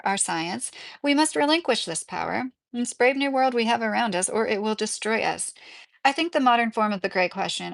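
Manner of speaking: usual speaking voice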